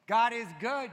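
loud God is good shout, pitch up. Shown as a demonstration. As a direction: show them how to shout it. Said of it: "It might do you good to go".

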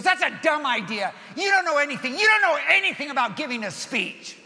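loud, high, furious yelling at dad; a shy boy finally explodes in anger; angry kid shouting. Retold or imitated. Son yells at dad over speech homework, furious shout. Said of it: "He goes".

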